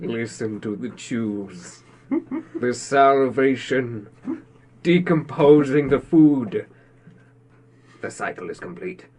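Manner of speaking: slow, hushed documentary-style narration; mock-solemn narrator. Podcaster narrating a co-host's chewing, mock solemn. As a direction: intone it slowly with long pauses.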